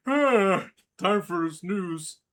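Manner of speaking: very slow, drawn-out sleepy line; sleepy character voice. Retold or imitated. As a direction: drag it out, half asleep. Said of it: "let's not forget this".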